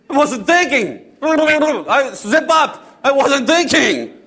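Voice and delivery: high, drawn-out panicked protest; flustered panic. Acted. Disciple regretting his shouted offer to Jesus, flustered panic.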